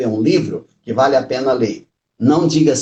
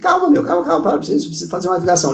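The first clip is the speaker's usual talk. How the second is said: high, urgent calma to an angry man; urgent plea to an angry driver; urgent calming; 5 semitones higher, much faster than usual. Retold or imitated. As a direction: rush to calm him down, pitched up.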